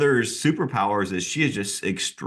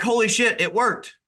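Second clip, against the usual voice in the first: much higher, thrilled burst, imitated; excited retelling; 11 semitones higher than usual. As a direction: imitate her rushing in overjoyed. Agent bursts in after success.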